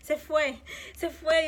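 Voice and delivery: a touch of retold panic, rising; panicked. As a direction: let the alarm build as he vanishes. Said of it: "Y yo entré como que en pánico".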